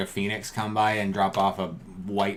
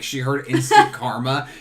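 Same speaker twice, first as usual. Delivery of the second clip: ordinary talk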